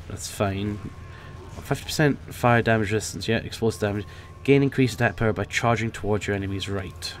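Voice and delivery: ordinary talk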